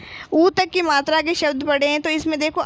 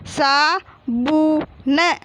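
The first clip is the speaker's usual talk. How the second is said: very slow, drawn-out सा, बू, न; teacher stretching each syllable of साबुन; much slower than usual. Shown as a demonstration. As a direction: sound out सा बू न as three separate pieces. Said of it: "और फिर क्या लिखा हुआ है?".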